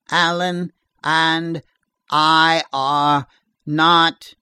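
slow, timed answering-machine voice; measured words with gaps between them; halting monotone. A narrator's reading. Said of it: "in a timed, halting monotone".

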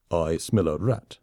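low, slow, deadpan; dry understated retort. Acted. Soldier insults the lemming colonel, slow deadpan. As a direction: let the insult land slowly.

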